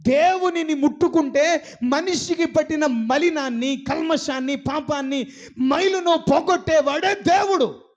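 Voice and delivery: a preacher declaring the true God; preaching proclamation. Real, not acted. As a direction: proclaim it with fervour.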